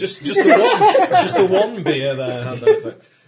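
laughing tease. Real, not acted. Mocking the drunk captain.

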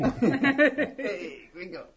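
high, laughing cartoon Mexican voice; hammed-up Mexican accent through laughter. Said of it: "doing a Mexican accent".

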